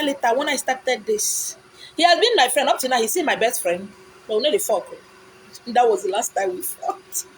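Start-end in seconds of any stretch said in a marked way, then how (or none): none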